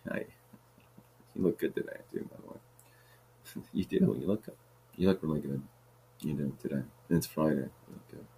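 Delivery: hushed playful compliments; half-whispered flattery; soft flirty aside. Real, not acted. Flirty aside to someone nearby.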